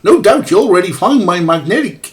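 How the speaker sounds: put-on jolly, overdone Canadian accent; bad Canadian accent. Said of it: "I'm trying to do a very bad Canadian accent".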